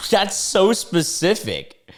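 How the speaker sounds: slow, high, stunned reaction; stunned disbelief. Real, not acted. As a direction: say it like you can't believe it. Reacting to oddly specific demand.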